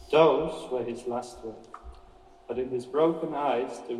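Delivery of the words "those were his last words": ordinary delivery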